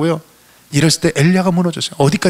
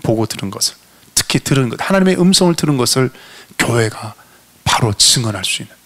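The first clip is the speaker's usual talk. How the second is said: ordinary talk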